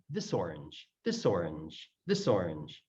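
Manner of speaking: "This orange" run together with no gap; low, joined-up "This orange"; linked as one word. Shown as a demonstration. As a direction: slide the s of "This" straight into "orange". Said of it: "so it sounds like one word".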